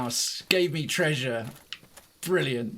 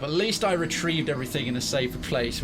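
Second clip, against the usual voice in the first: usual speaking voice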